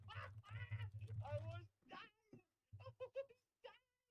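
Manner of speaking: high, slow wheezy laughing words; helpless laughter. Real, not acted. Podcaster dying at a friend's racism joke, helpless laughter. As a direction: laugh so hard the words stretch out.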